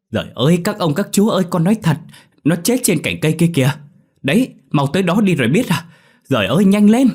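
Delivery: high, loud frantic urging; desperate shouting. A narrator's reading. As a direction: beg them to hurry, almost screaming. Boy desperately urges the adults.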